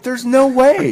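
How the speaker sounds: high there's no way; disbelief. Retold or imitated. Dad doubting his son about the roof, high disbelief. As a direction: scoff at the idea.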